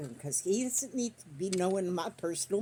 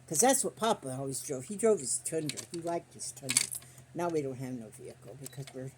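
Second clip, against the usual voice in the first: usual speaking voice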